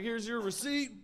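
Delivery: drawn out proudly; slow, high cashier voice. Retold or imitated. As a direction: cashier handing over the receipt with flair. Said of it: "He's like".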